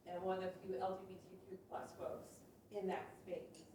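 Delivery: ordinary talk, nothing marked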